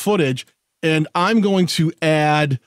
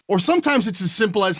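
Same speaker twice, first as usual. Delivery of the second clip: ordinary talk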